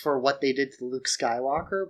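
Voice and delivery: ordinary delivery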